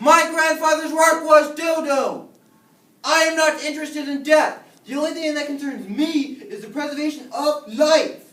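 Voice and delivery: loud, high, emphatic outburst; furious shout. Acted. Frankenstein disowns his grandfather before class, furious shouting. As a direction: blow up and declaim it. Professor loses his temper.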